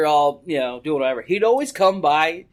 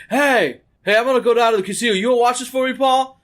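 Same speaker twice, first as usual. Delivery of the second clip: higher-pitched call to a buddy; retold holler over to a friend, rushed in the middle; 7 semitones higher, faster than usual. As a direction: call over to get a pal's attention. Acts out drunk friend calling over.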